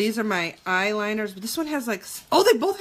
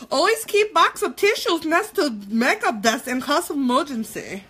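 put-on light Russian accent, articles dropped; a hint of Russian accent, half-joking; 4 semitones higher than usual. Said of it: "Now I'm fucking Russian".

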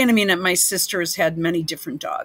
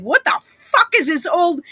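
higher-pitched imitation of a baffled dog; mock bewilderment; 8 semitones higher than usual. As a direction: voice the dog's imagined disbelief, pitched up. Voices the dog's reaction.

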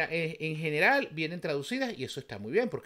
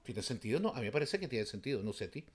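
ordinary talk

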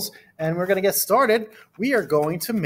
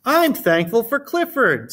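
Emily Elizabeth; 7 semitones higher than usual. Acted.

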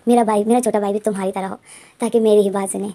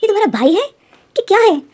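high, loud shocked question; shocked disbelief; 9 semitones higher, 5 dB louder than usual. Retold or imitated. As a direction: gasp it out, stunned by the sight. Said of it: "shock में हो जाते हैं". (हैरान होकर ऊँची, ज़ोरदार आवाज़ में)

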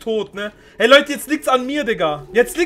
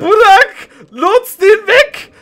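urgent shout; 9 semitones higher, 11 dB louder, much slower than usual. Real, not acted. Urgent call to friend.

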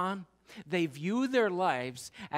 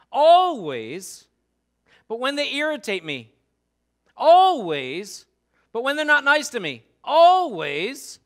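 always shouted loud and high, building; 7 semitones higher, 12 dB louder than usual. Acted. Preacher shouting always at the congregation, loud. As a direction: hammer always home with loud cries.

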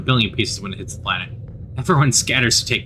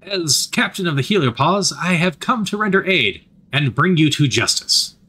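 higher, grandiose military announcement; pompous commander; 5 semitones higher than usual. A narrator's reading. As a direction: proclaim it like a self-important officer.

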